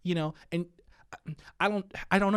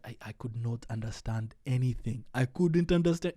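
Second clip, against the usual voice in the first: imitated African accent, baffled newcomer. Son quotes his dad after church, in an African accent. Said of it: "my dad has a thick African accent".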